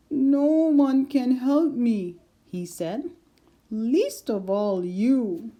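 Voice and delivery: loud, slow, hopeless lion; a trapped king giving up; despairing lion. A narrator's reading.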